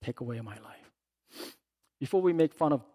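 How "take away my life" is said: softer, muttered take away my life; quiet quote. Retold or imitated. Preacher quoting Jonah's plea, quietly. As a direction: say it low, almost to yourself. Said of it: "So that's what he's saying here".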